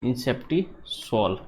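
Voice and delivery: इंसेप्टिसॉल said slowly and deliberately; slow teaching repeat. Shown as a demonstration. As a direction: stretch out इंसेप्टिसॉल for dictation. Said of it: "कौन सी?". (इंसेप्टिसॉल को धीरे से दोहराना)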